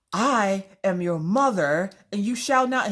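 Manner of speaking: slow, high, grand mock-offended matriarch; old-fashioned melodrama. Mother asserting herself to her son, old-fashioned melodrama. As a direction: proclaim it like an antique stage heroine. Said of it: "talking like you're from the nineteen hundreds".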